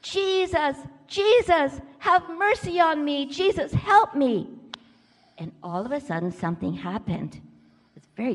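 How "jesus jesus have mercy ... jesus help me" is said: high, insistent calling out; acted yell. Blind Bart keeps calling to Jesus, pleading yell. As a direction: cry out for help, higher and louder. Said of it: "So he yelled".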